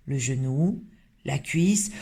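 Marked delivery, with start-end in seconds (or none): none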